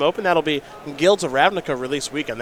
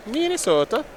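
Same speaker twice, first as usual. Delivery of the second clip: mock Minnesota accent; 9 semitones higher, 3 dB quieter than usual.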